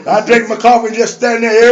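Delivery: ordinary talk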